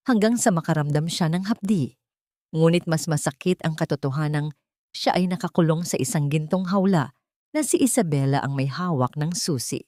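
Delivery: plain reading voice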